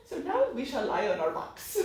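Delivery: a yoga teacher's put-on Indian accent, half laughing; mock Indian accent. Mocking loud spiritual teachers with a laughing Indian accent. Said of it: "Apparently talk in an Indian accent".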